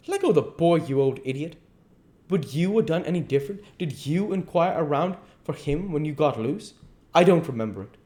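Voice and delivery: high, scolding challenge; indignant duke. Acted. Duke telling the king to let the boy go, indignant scolding. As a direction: rebuke him with pointed questions.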